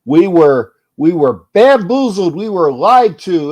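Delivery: loud indignant rant. Retold or imitated. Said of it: "the scene from A Stephen Smith".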